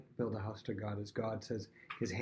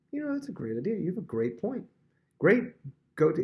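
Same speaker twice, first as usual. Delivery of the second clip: eager approval; 8 semitones higher, 6 dB louder than usual. Retold or imitated. Nathan eagerly blesses David's plan.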